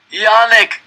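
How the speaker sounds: high, loud anguished moan; a beaten friend calls out; moaning cry. A narrator's reading. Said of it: "Fred moaned".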